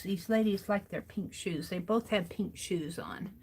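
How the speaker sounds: whispered to herself. Real, not acted. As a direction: breathe it under your breath. Whispering to herself while coloring.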